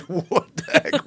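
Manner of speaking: high, slow, laughing disbelief; shocked. Retold or imitated. Caught squatting in the forest, laughing shock. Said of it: "I'm like".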